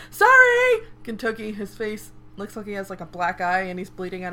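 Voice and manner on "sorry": much higher, louder shrieked apology; scream. Retold or imitated. Kagura yelling back while fleeing, high shriek. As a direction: yell it back while running off. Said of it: "she just screams as she's running away".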